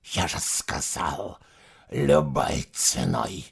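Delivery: low, slow menacing hiss. Acted. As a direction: hiss it coldly. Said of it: "прошипел Суарак".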